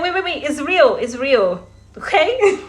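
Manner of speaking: high, loud yelp of alarm; startled protest. Retold or imitated. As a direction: protest loudly as your hair is yanked. Hair pulled hard at market.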